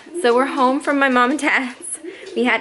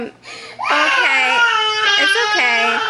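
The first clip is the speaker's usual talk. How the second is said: high, gentle soothing; calm the crying little one; 11 semitones higher, much slower than usual. Real, not acted. Mother calming upset toddler.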